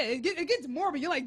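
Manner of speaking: normal talking voice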